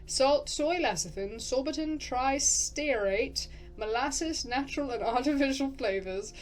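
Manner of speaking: generic English-accented list reading; lilting, loosely English accent; vaguely English narrator accent. Shown as a demonstration. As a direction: recite ingredients in an English-ish narrator voice. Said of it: "I've kind of gotten it back".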